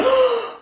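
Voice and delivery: sharp gasp pitched very high; imitated shocked gasp. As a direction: mimic her stunned intake of breath. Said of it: "She went".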